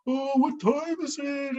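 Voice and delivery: slow, high yawned-out words; hammed-up yawning to show you want to leave; exaggerated yawn. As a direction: talk right through a big fake yawn. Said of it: "and I'm yawning".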